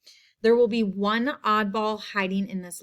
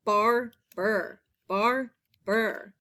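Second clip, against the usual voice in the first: "bar... ber", a gap between halves; syllable by syllable; much slower than usual. Shown as a demonstration. Teacher sounding out the last word, "Barber" split in two. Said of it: "first syllable".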